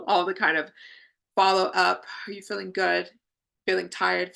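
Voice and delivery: ordinary delivery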